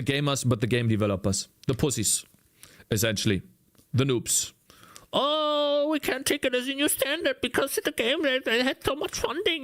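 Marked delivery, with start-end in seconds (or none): 5.1-9.6 high, mock-whiny developer voice. Streamer mocking game developers, high whiny mock voice. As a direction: squeak it out like a sulking dev.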